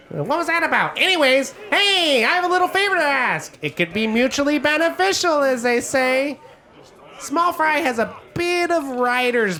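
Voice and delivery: high gnome voice, eager then scornful; Gilly the gnome. Acted. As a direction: gnome asks a favour, mocking Small Fry.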